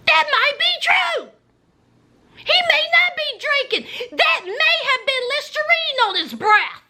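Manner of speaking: very high, mock-hopeful voice; wishful thinking. Woman still in love thinking to herself, mock-hopeful. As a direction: talk yourself into it in a high squeal. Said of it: "thinking to myself".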